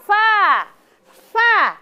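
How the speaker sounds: "fa" with a clear lip-teeth hiss; letter fa. Shown as a demonstration. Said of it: "Yang pertama adalah huruf".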